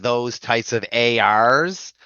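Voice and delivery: ordinary talk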